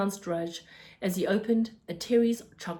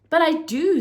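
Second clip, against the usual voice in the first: loud, high, furious insistence; angry; 8 semitones higher, 10 dB louder than usual. Acted. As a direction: fire it back defiantly. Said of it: "with fire in his hi-, eyes".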